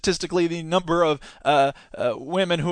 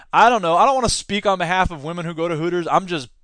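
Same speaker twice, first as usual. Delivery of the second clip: ordinary talk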